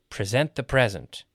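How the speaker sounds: first PRESENT pre-ZENT, second PREZ-ent; contrasting syllable stress. Shown as a demonstration. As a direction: verb PRESENT stressed late, noun PRESENT stressed early. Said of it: "verb gets the stress on the second".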